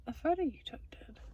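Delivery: soft hushed whisper. Real, not acted. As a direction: keep it to a breathy whisper. Whispering beside sleeping Jonathan.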